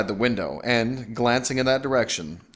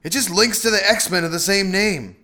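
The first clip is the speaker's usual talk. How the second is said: calling over noise; 10 semitones higher, 5 dB louder than usual. A narrator's reading. Husband calling over banshee din.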